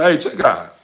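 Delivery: man setting his partner straight; telling her straight. Retold or imitated. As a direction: shut her down, raising the voice. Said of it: "they gonna tell your ass straight like this here".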